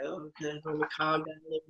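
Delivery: lightly imitating a monotone doctor; low-key doctor. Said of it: "he got even more low-key".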